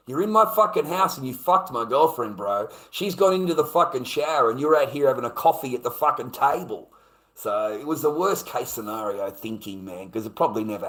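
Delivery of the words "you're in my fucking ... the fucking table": loud, indignant, higher-pitched rant; angry accusation. Retold or imitated. Man accusing intruder over girlfriend and shower, angry. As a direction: pile up the evidence heatedly.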